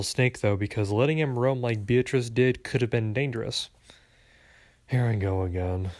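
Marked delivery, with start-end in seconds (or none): 4.9-5.9 low, weary grumble; grumbling. A narrator's reading. Coworker complaining about the customers, grumbling. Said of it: "my coworker grumbled".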